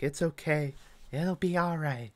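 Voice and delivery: soft, comforting murmur; soothing. Retold or imitated. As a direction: quietly reassure a crying boy. Mother comforting crying son.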